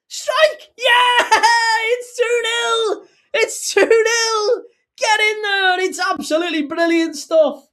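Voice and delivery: high, screaming goal call; ecstatic shouting. Real, not acted. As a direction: roar the goal home in joy.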